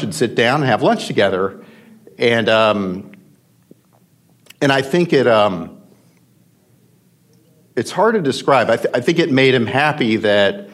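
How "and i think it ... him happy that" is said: holding back tears; emotional. Real, not acted. Guest recalls what lunch meant to Packard, choked up. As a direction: voice tight with emotion, pitched higher, with long pauses. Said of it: "I'll keep my composure after that".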